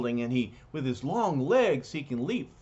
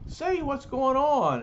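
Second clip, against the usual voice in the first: put-on teen brigader voice; 12 semitones higher, 5 dB louder than usual. Teen waking up, high voice.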